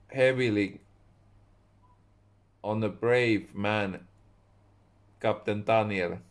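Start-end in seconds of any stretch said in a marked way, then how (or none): none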